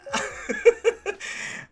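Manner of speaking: breathy high-pitched laugh; airy giggle. Real, not acted. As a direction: burst out laughing. Laughing at missed trade.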